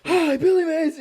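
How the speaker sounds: shrieked high, loud pitchman greeting; Billy Mays greeting. Pretend. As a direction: yell it like a hyped TV pitchman. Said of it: "Insert audio clip of Billy Mays".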